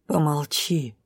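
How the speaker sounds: low, softened gruff order; gloomy grumble. A narrator's reading. Drow cuts the heroine off, gloomy grumble. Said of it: "буркнул мужчина хмуро".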